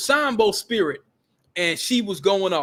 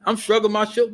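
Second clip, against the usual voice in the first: ordinary talk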